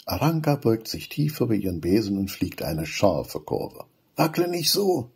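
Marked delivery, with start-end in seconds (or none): 4.2-5.1 put-on high, whiny kid complaint; complaining Benny. A narrator's reading. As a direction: complain he's wobbling. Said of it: "beschwert sich Benny". (beschwerend, hohe Kinderstimme)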